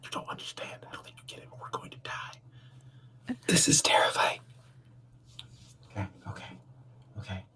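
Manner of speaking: terrified whisper. Pretend. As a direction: whisper it, scared for your life. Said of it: "Why are we whispering?".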